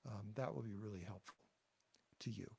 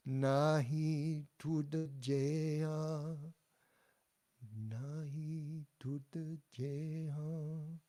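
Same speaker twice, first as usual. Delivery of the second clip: "Nahi tud jeha" very slowly, higher, syllables drawn out; slow model line; 4 semitones higher, 5 dB louder, much slower than usual. Shown as a demonstration. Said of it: "you repeat it after me".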